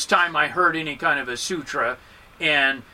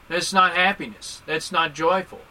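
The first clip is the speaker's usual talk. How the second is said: ordinary talk, nothing marked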